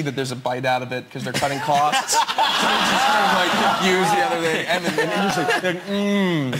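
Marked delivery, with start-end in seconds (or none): 5.7-6.5 long hum of feigned delight; pretend-eating moan of pleasure; relishing "mmm". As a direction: hum as if loving every bite. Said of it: "eat it like that you love it".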